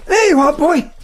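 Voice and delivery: high, playful pet voice; calling to a dog. Acted. Visitor throwing the ball up to the dog, high and playful. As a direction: encourage the dog to fetch.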